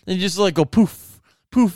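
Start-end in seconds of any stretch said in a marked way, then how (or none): none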